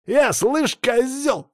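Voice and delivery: very high-pitched indignant outburst; squawked insult back at him; outraged retort. Acted. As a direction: jump in, offended and ready to fight. Саня вскидывается на оскорбление.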